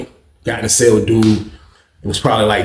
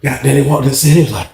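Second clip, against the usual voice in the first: ordinary talk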